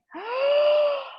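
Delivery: sharp high gasp of horror; high shocked gasp. Retold or imitated. Teacher reenacting shock at the receipt, high gasp. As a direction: gasp like you've seen the bill.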